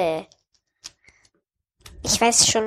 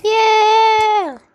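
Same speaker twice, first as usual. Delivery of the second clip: excited cheer; 8 semitones higher, 5 dB louder than usual. Real, not acted. Child cheers at seedless grapes.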